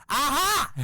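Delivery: high, loud gotcha cry; triumphant aha. Retold or imitated. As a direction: crow it like you called it. Wife gloating again.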